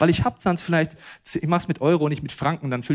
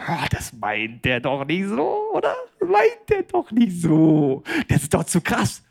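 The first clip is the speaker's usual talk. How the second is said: mock protest; 2 semitones higher, slower than usual. Preacher mimics listeners balking at what the Bible says, mock protest. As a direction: stretch it out in exaggerated disbelief. Voices objecting churchgoers, drawn out and high.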